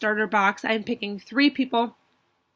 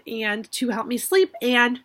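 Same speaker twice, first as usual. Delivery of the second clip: ordinary delivery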